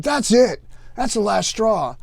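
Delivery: the final straw, fed up; exasperated gunny. Retold or imitated. Gunny fed up with the corporal, exasperated outburst. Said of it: "he's like".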